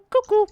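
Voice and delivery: cuckoo sung out high and loud; imitated cuckoo call. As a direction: pipe cuckoo like the clockwork bird. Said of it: "ticking bird is just going cuckoo".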